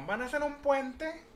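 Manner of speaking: a hint of the physics teacher, announcing slowly; imitating teacher. Teacher announcing the bridge project, lightly mimicked. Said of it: "la maestra nos dijo".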